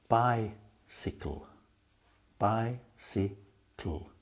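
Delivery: each syllable drawn out slowly; slow, careful teacher's example; slow clear demo. Shown as a demonstration. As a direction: spell it out slowly for the class. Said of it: "Let me give you an example".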